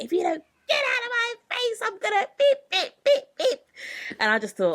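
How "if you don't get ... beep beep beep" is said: high, shrill-pitched mock threat; angry threat. Friend threatening the girl in her face, high and heated. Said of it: "my friend was like".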